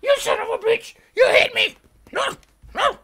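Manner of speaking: very high, loud squealing screams; mock hysterical mother. Mother threatens to call police on her child, mock hysterical screaming. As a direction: imitate a mother shrieking in exaggerated panic.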